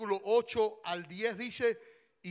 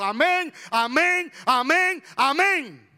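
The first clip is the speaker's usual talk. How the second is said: loud, high, repeated shouts; fervent congregant's amens; 6 semitones higher, 10 dB louder, slower than usual. Shown as a demonstration. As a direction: cry out each amen like an eager churchgoer. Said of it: "si yo estuviera de ese lado, estuviera diciendo".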